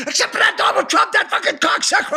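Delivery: high, loud comic rant; mock outrage. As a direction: burst into hammed-up cursing. Sign's pious slogan turns into abuse.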